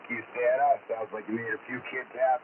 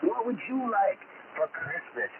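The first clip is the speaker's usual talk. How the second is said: put-on higher, hearty Santa Claus with lots of oh boys; jolly Santa voice; 10 semitones higher than usual. Santa on the radio greeting kids, jolly and hearty. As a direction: play a cheerful Santa over the radio to excited kids.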